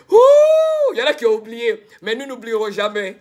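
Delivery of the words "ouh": a very high, loud whoop of joy; excited whoop. Real, not acted. Streamer whooping to viewers over the trophy win, joyful. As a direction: let out a victory hoot. Celebrating the Africa Cup win.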